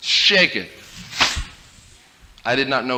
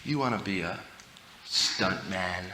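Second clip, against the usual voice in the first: much softer, lower coaxing offer; sly tempting; 6 semitones lower, 13 dB quieter than usual. Retold or imitated. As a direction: lure the little brother in quietly. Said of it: "I said".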